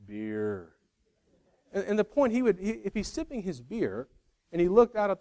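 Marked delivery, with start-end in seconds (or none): 0.1-0.8 low, dopey cartoon dad moaning one word; lower, like a cartoon dad dreaming of a drink; a quick, lower cartoon-dad groan of longing; Homer Simpson. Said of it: "like Homer Simpson watching that guy and going".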